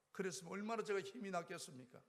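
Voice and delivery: ordinary talk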